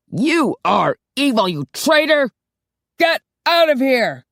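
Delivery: high furious shout; hammed-up furious boss yelling; yelled. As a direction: scream him out the door. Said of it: "Sean yelled".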